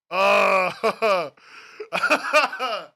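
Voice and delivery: loud, long exasperated groan; frustrated groan. Real, not acted. Streamer groans in frustration at website.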